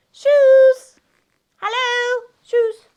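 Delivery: high, loud call for something missing. Pretend. Storyteller calls for her lost shoes, calling out high.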